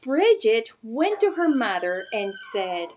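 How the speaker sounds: usual speaking voice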